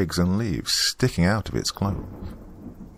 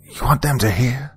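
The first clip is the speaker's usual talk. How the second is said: higher, strained character voice; paranoid old woman; 7 semitones higher than usual. A narrator's reading. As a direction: warn urgently, as if others might overhear. Paranoid woman warns after shushing.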